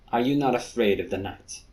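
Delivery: ordinary delivery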